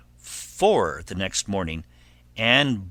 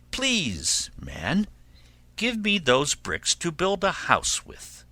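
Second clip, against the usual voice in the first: high, polite piglet voice; pleading little pig; 7 semitones higher, 3 dB quieter than usual. Acted. Pig asks man for bricks, high-voiced plea. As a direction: plead sweetly in a higher pitch. Third pig begs in story.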